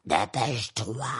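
icy, slow, far-off voice; slow cold command from the dark; ice spirit. Acted. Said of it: "la voix glacée".

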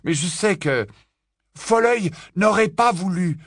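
Harry pleading his case; Harry's voice. A narrator's reading. Harry objecting.

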